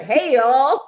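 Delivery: loud imitation American greeting; American accent. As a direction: put on a big American drawl. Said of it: "They're American".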